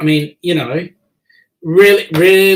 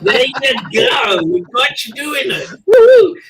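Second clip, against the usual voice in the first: whooping in delight, pitch leaping up; delighted cheer; 8 semitones higher, slower than usual. Real, not acted. Host whoops as the guest finally talks Aussie. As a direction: cheer the guest on with a big woo-hoo.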